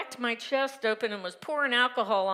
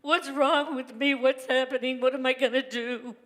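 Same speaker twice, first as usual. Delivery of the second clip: higher, louder despairing cry; desperate plea rising to a wail; retold sobbing plea; 4 semitones higher, 5 dB louder than usual. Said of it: "Just sobbing to God, going".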